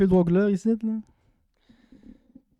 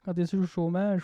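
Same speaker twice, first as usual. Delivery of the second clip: ordinary talk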